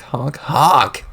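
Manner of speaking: bigger clown horn honks, falling at the end. Acted. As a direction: blast the honks like a creepy horn. Clown honks before the kill.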